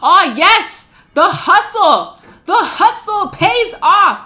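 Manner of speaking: loud, drawn-out celebration; crowing over a hard-won success; triumphant cheer. Real, not acted. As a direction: punch out each phrase in loud triumph. Celebrates progress in the game.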